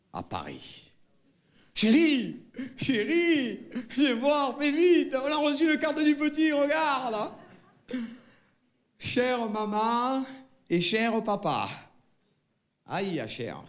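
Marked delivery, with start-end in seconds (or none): 1.7-7.3 excited mother calling. Acted. Mother calls father to see son's gift. 9.0-11.7 slow, higher letter opening; letter reading. Acted. Son reads his letter to parents.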